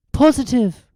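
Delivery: high firm answer; sure Hermione. A narrator's reading. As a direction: say it without doubt.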